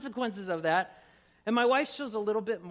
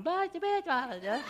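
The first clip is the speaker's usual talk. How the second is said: high-pitched, oblivious babble; higher-pitched mock prattle; mock chatter; 6 semitones higher than usual. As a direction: imitate someone nattering away. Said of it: "Kelly's just talking".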